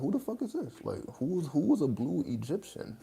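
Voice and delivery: high, incredulous voice easing down slightly; high-pitched bafflement. Retold or imitated. Dreamer asking himself about the blue Egyptian, high-pitched bafflement. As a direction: squeak out the question, utterly puzzled.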